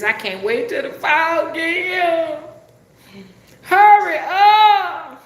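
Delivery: rising into a drawn-out, high shout; mock desperate wail. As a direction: cry out slowly, begging for relief. Complaining loudly about the heat.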